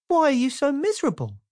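high, quick, bright question; cheerful Mister Happy. Acted. Mister Happy quickly asks Mister Miserable why he is sad. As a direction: ask with chirpy concern.

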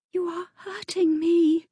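high, fearful protest; girl pleading with her attacker; frightened plea. Acted. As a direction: tell him he hurts you, afraid. Girl held by angry viscount.

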